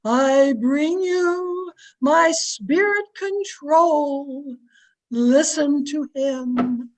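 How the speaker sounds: very slow, droning medium in a trance. Acted. Medium announces she is in a trance, slow hollow-theatrical intoning. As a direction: drag every word out like a voice from beyond. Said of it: "I will go into a trance".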